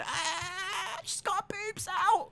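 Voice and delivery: very high, squealed cry of shock; mock panicked wail. Host imagining being the only one who noticed, wailing in mock shock. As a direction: squeal it like a hysterical tattletale. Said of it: "And I'm here going".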